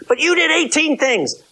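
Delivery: high squeaky blaming voice; squeaky mocking accuser; mock accusation. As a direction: whine the accusation like a petulant spouse.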